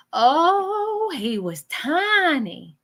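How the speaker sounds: high, drawn-out "oh", slow "tiny"; cooing over a baby. Retold or imitated. Medium relaying a woman's words on a baby, cooing. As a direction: coo it slowly, as if over a baby. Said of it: "Just like that's exactly how".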